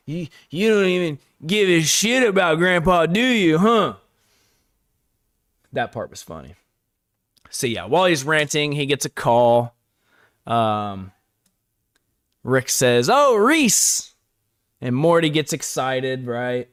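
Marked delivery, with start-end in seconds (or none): 0.1-4.0 put-on higher, slurring drunk accusation; drunken rant. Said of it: "another drunken posture". 13.1-14.1 put-on high, thrilled drunk hello; light up at the friend's name; delighted greeting. Rick answers call delighted.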